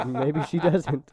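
high burst of laughter, then slow words; laughing. Real, not acted. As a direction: laugh through it, drawing it out. Laughing at own joke.

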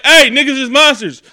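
loud, high call-out; shouted warning. Acted. Would-be victim warning crewmates of monsters, shouted warning. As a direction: yell out to alert the others.

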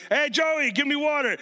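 high-pitched holler to another room; calling out. Acted. Parent asking kid in kitchen for water, high called-out request. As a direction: call it across the house, lightly. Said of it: "You couldn't just be like".

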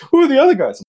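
high-pitched, loud worried squeal; acted-out alarmed question; mock panic. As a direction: imitate a panicky buddy, voice shooting up. Worried buddy asks about Russians.

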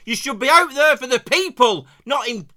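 loud, furious rant; angry shouting. Acted. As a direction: shout it down at him like a fed-up voter. Escalating rant at the candidate.

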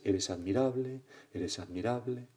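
repeated call, trailing off in volume; imitated echo. Mountain returns the father's words, fading echo. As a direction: echo the praise back, fading away. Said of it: "el eco devuelve sus palabras".

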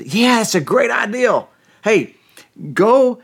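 high, excited acted reply; eager agreement. Pharaoh's daughter answering Miriam's offer, eager and excited. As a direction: jump on the idea with lifted pitch.

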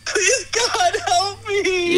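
high, crying plea to God; sobbing prayer. Acted. Therapist alone after the hang-up, sobbing to God. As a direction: sob it out, desperate.

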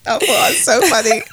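slow words through laughter; laughing. Real, not acted. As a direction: still giggling as you speak. Still laughing at joke.

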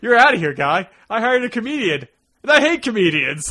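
high and loud, throwing them out; mock angry manager. Theater owner firing the magicians, hammed-up angry rant. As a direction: bark them off the stage in mock fury. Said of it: "theater is like".

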